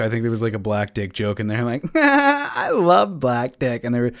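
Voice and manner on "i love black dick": mock fan girl. Mocking the girls again.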